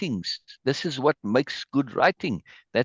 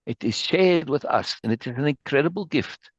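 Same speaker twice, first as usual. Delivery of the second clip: ordinary talk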